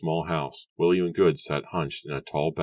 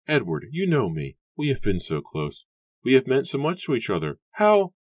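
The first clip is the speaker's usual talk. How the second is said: pleading, climbing high and louder to a cut-off; voice catching; 9 semitones higher, 4 dB louder than usual. A narrator's reading. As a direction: plead higher and higher, then break off. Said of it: "Her voice caught in her throat".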